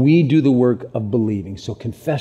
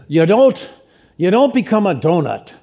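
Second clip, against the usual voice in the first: Roy; 6 semitones higher, 4 dB louder than usual. Retold or imitated. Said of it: "Roy put it like this".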